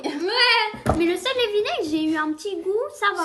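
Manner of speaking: buzzing with a win; excited. Real, not acted. Happy after getting all right.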